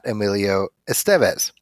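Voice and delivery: Estevez stressed on its middle syllable; second-syllable stress. Shown as a demonstration. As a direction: say Estevez as es-TEV-ez. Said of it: "and not".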